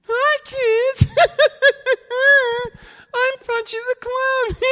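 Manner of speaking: crummy, squeaky-high clown voice with a giggle; ridiculous falsetto clown, loud and cackling; Punchy the Clown. Shown as a demonstration. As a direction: put on a terrible, extremely high clown voice. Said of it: "you wanna hear the, the crummy voice".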